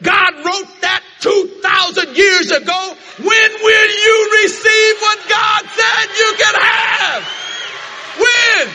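full-force preaching. Acted. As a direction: preach it to the rafters.

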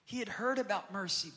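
ordinary talk, nothing marked

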